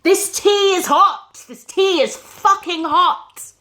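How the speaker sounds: husband screaming about hot tea; furious shout. Acted. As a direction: roar it at her like an abusive husband. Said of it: "he started shouting".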